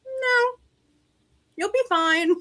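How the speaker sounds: high, drawn-out mock reassurance; dismissive reassurance. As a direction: wave the problem away in a high voice. Team waves away her plea.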